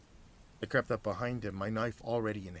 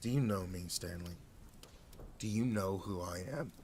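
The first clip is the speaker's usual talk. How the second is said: growled; 2 semitones lower, 4 dB quieter than usual. Acted. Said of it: "I growled".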